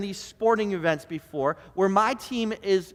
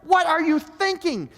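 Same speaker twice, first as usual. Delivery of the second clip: higher and louder barked coaching calls; armchair coach yelling; 7 semitones higher, 3 dB louder than usual. Retold or imitated. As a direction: shout plays at the screen like you're the coach. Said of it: "And I'll start to yell at the T V".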